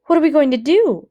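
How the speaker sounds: high and loud, a frightened plea; anxious question. Acted. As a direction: ask it with rising panic. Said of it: "I said anxiously".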